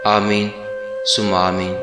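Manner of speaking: ordinary delivery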